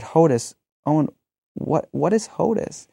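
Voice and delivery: rising bemused what-on-earth question; puzzled question. Retold or imitated. Parents asking their son about his made-up word, bemused and rising. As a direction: ask the toddler, amused and baffled, pitch climbing high.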